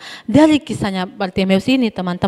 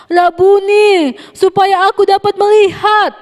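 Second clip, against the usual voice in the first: pleading blind man; 9 semitones higher, 4 dB louder, slower than usual. Acted. Blind man answers Jesus.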